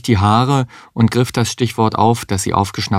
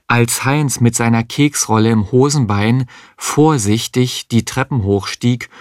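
plain reading voice

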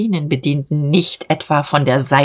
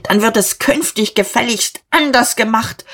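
raised, high-pitched angry order; angry shout; 6 semitones higher than usual. A narrator's reading. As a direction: cry out the order to have the last word. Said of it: "Er schrie noch".

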